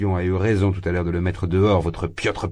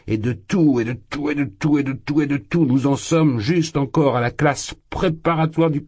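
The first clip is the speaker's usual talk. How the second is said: excited shouting; 6 semitones higher than usual. Acted. Drunk young man shaking the ladies' hands, crying out. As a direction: pound the words home, carried away. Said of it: "criait Razumikine en secouant".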